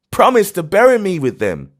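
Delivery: high, loud urgent begging; desperate plea. Acted. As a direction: grip his arm and demand the promise. Old man seizes narrator's arm.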